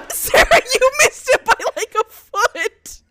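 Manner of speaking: high laughing tease. Real, not acted. Teasing friend after missed throw.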